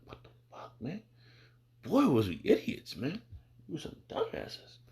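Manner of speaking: hushed, half-voiced confession to himself; rueful whisper. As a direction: whisper it like shaking your head at the past. Looking back on past foolishness.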